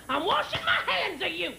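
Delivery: high, drawn-out mock scream; imitated screaming. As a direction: screech it in exasperation, same rhythm each time. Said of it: "He's screaming".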